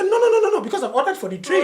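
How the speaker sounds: high, quick-fire mock fatherly refusal; imitating a dad who won't budge; insistent father. Dad refuses son's change of mind.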